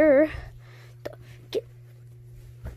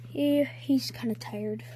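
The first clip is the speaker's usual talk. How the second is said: soft unvoiced whisper; hushed aside; 7 dB quieter, slower than usual. Real, not acted. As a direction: whisper so you don't wake him. Whispering about a tired character.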